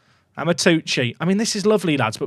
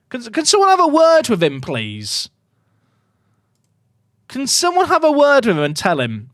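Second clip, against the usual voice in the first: exasperated plea; 7 semitones higher than usual. Real, not acted. Streamer pleading about his right back pressing too far, exasperated. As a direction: beg someone to sort him out.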